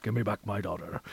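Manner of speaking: low, quiet, menacing growl; Liam Neeson impression. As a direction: threaten softly like a vengeful father. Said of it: "you're Liam Neeson".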